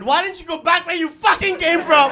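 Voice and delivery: shouted high and loud, mock outrage; hammed-up angry shout; mock yell. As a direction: bellow it at the kid as a joke. Mock outburst at daughter.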